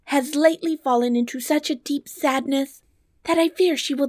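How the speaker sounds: high, earnest princess voice; worried princess. Acted. Princess explains the queen's sadness to the dwarf, high worried voice. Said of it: "replied the princess".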